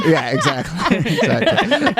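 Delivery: amused, words broken up by laughter; laughing agreement. Real, not acted. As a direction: agree through real laughter. Laughs at co-host's joke.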